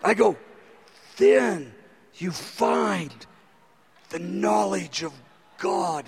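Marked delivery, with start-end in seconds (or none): 1.2-6.1 low, halting, hushed re-reading; soft and slow, phrase by phrase, near tears; choked-up awe. Retold or imitated. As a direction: retell being overwhelmed by the verse. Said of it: "I begin to tear up".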